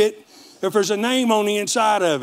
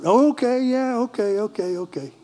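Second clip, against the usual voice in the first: mocking okay; 5 semitones higher than usual. Brothers mock his claim.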